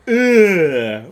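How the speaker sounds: "ugh" as one long, loud, falling Finnish vowel; Finnish pronunciation. Shown as a demonstration. Streamer showing the Finnish word for night, "ugh" as a long "yööö". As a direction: stretch "ugh" into a drawn-out "yööö". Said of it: "What's the word night in Finnish".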